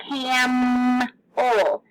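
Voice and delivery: "camel" with a long held M; stretched-out consonant. Shown as a demonstration. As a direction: draw out "camel" as cammm-el. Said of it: "So I held out".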